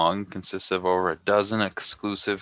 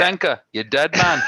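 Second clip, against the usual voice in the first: hammed-up Jamaican accent, higher; attempted Jamaican accent, badly; 7 semitones higher, 4 dB louder than usual. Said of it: "can't do a Jamaican accent".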